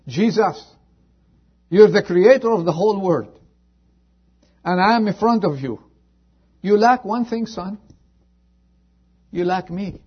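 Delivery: loud, high fervent appeal; a preacher pleading for a soul; impassioned plea. Retold or imitated. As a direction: cry out to the Lord. Preacher voicing plea to Jesus.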